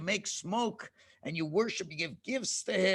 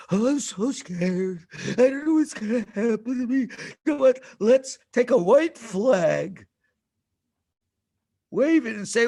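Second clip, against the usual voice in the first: mock fear; 4 semitones higher, 6 dB louder than usual. Frightened tribe wants to surrender.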